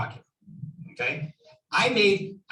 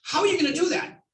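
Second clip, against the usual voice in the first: mock skeptical seller; 8 semitones higher than usual. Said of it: "to me, it's like".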